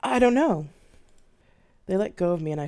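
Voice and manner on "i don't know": much higher, much louder admission; crying out the truth; stronger. Acted. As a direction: force the words out bravely. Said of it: "said, stronger this time".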